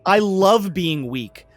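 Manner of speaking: loud, slow, hammed-up gloating loser; mocking a proud loser. Host mocking a writer who fails on purpose, loud sneering loser voice. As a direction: sneer it out big and slow, like you're proud to suck.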